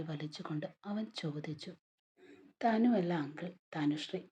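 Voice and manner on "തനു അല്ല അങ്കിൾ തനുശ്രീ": louder insistent correction; little girl correcting the uncle; correcting her name. A narrator's reading. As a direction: stress the full name Tanushree. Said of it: "അവൾ വീണ്ടും തിരുത്തി".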